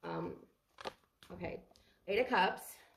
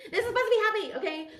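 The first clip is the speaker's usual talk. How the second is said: high, louder, laughing through it; laughing protest; 12 semitones higher, 5 dB louder than usual. Real, not acted. Tarot reader catching herself getting sad mid-reading, laughing it off. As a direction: laugh off the urge to cry. Laughing off her sudden sadness.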